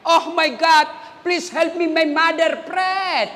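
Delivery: a hint of a child praying in English, slow at the end; mock a kid praying in English to make the crowd laugh; lightly hammed-up English prayer. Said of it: "dia berdoa pakai bahasa Inggris".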